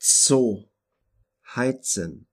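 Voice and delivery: Zoo and heizen with Z said as ts. Shown as a demonstration. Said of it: "combination of the sounds T and S".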